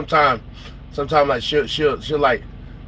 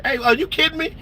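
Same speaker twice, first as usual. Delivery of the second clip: high-pitched mock disbelief; retold parental outrage; incredulous scolding; 11 semitones higher than usual. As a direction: pitch jumps up, appalled. Acted scolding of a child.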